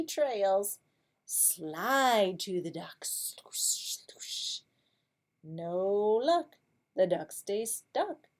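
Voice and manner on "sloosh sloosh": whispered sloosh. Acted. Snail sound effect in story.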